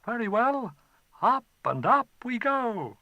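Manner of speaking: put-on a boy calling the dragon's bluff; boy teasing the dragon; boy Chai.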